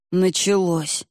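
lightly voiced grumbling grunt; a touch of weary creakiness; grunting old man. A narrator's reading. Man getting up from the bench with effort, grunting. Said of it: "прокряхтел из угла Дмитрий Михайлович".

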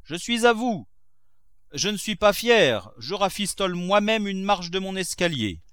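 high, loud call from the stairs; host calling out to arriving friends, loud. A narrator's reading. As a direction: shout it over to his guests. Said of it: "cria Grandet".

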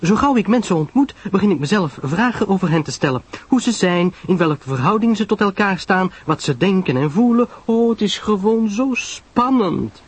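high, gushing enthusiasm; Pamela Lyle, thrilled. Acted. Pamela tells Poirot how exciting people are, high and excited. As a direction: run on eagerly, stretching the final thrill.

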